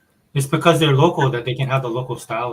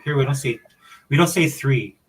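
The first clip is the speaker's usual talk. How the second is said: ordinary delivery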